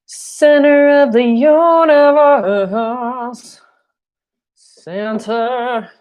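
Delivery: high, lofty, slowed declaration; playful fake-solemn announcement; mock grand proclamation. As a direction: pronounce it like a grand cosmic truth, tongue in cheek. I'm just kidding.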